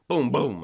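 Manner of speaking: mock gunshots, loud and punchy; gunshot noises. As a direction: fire off two pretend shots. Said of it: "Why would he go".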